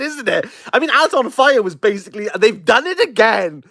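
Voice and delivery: usual speaking voice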